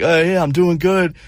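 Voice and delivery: a hint of forced friendliness; playing along. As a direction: fake cheerful reply. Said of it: "I'm like".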